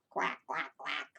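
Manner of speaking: low mock quacking like a duck; duck quacks. Shown as a demonstration. Teacher shows children a duck's quack, low mock quacks. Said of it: "What does a duck sound like".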